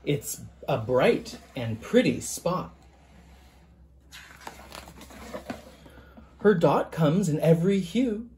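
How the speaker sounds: gentle picture-book reading, even and measured; story narration. A narrator's reading. Author reading his picture book to listeners, storytelling voice. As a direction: read it aloud to a class of children.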